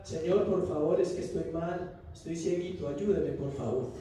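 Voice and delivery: a hint of a meek pitiful beggar; whiny soft begging. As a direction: beg timidly and quietly like a sick child. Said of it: "no era".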